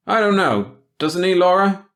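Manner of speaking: put-on a boy egging his girlfriend on; young lad, loud and eager. A narrator's reading. Bill teasing, loud.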